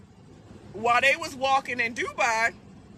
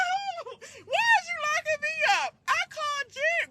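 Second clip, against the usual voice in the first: pitched way up, outraged and whiny; a shrill wail of disbelief at the cop; high squealing protest; 12 semitones higher than usual. Retold or imitated. Arrested woman to officer, high whiny protest.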